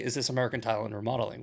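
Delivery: fast, businesslike customer call; brisk customer line. Shown as a demonstration. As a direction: rattle off the company name like a real client. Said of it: "They would say".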